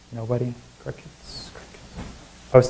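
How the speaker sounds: whispered comment on the silence; hushed aside. Real, not acted. Speaker to an audience giving no answer, hushed aside. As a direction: drop to a soft, voiceless whisper.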